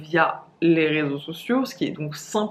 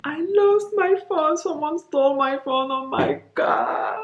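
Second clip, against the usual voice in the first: high, slow, near-crying wail; distraught panic; 9 semitones higher, 4 dB louder, slower than usual. Retold or imitated. In the street after the theft, a high crying wail. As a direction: fall apart over the stolen phone. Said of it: "m'effondrer par terre".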